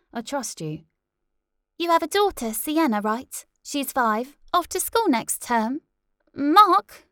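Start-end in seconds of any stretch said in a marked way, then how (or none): 1.8-5.8 high, brisk presenter voice; bright TV host. Acted. TV host questioning. 6.3-7.1 high loud call of a name; sharp call. Acted. Host calls him back.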